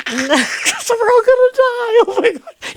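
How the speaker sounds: high, slow mock wail of doom; hammed-up panic at a night safari among elephants. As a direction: wail it like we're all doomed, half laughing. Mock panic about dying.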